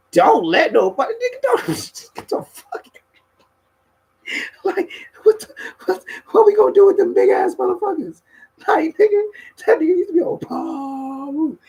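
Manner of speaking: high, laughing incredulous yell; mock disbelief. Friends mocking Dulo's shoe rule, high and laughing. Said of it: "We be like".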